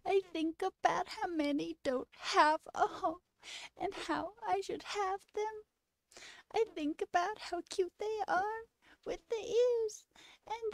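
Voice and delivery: high, teary sing-along imitating the clip; lightly wobble it like you're about to cry; a hint of fake tears, pitched high; mock emotional. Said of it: "I get emotional".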